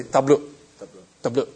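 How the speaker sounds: "Tablo" said as TAH-bloo, stress on the first syllable; Burmese greeting. Shown as a demonstration. Showing the class how Burmese Christians greet, "Tablo" as TAH-bloo. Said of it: "and you say".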